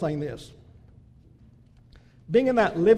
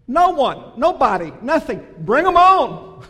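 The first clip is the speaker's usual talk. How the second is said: rising defiant cry, louder and higher, last words slow; defiant shout; 4 semitones higher, 9 dB louder, slower than usual. Real, not acted. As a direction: throw down the challenge to the whole room. Defiant climax against all accusers.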